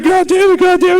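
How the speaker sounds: high frantic mock yelling; panicked yelling bums. Retelling bums woken by the hose, high frantic yelling. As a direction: squawk it like drenched drunks waking. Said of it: "They're like".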